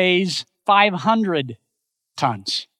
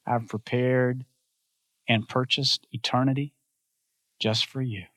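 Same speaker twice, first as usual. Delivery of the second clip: tender welcome; 8 semitones lower, 7 dB quieter than usual. Retold or imitated. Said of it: "And he says".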